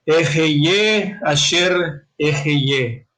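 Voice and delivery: "Ejeye asher ejeye" very slowly, in deliberate Hebrew; Hebrew pronunciation. Shown as a demonstration. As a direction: sound out "Ejeye asher ejeye" as slow, drawn-out Hebrew. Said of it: "aquí viene la pronunciación". ("Ejeye asher ejeye" en hebreo, pronunciado muy despacio)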